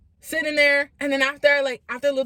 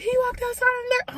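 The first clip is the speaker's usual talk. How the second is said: much higher, stunned echo; mock disbelief; 14 semitones higher, faster than usual. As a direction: parrot it back in shock. Parents repeat in disbelief.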